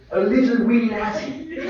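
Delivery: a playful Scotsman punchline through laughter; Scottish accent. Retold or imitated. Retelling Glenn's Scotsman joke to the audience, laughing Scottish accent. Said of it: "some impression of a Scotsman".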